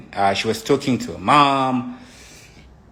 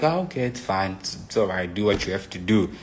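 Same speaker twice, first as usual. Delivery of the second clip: softer, withdrawn giving in; quiet resignation; 3 semitones lower, 6 dB quieter, faster than usual. Retold or imitated. His partner gives up on the argument, softer and withdrawn. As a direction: let it go in a small voice. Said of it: "and she's just quiet".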